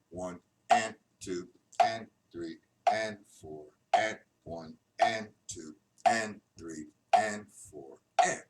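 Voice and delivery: each "and" leaned on, count falling after; counting with stress on and. Shown as a demonstration. Drum teacher counting the beat, stressing each "and". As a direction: lean into every "and" in the count. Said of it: "it falls on the ands".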